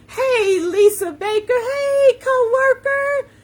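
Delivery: high, loud, drawn-out squeals of hello; excited greeting. Real, not acted. Host greeting her coworker on the live, excited greeting. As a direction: call out gleefully to a friend.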